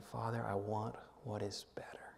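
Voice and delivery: softly whispered prayer. Shown as a demonstration. Pastor modelling the quiet prayer for the congregation, whispered. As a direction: breathe the prayer barely aloud. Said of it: "you pray again".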